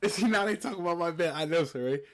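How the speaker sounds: loud and high, cracking up; excited laughing outburst. Real, not acted. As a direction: burst out laughing at the chat. Laughing at chat comments about bed.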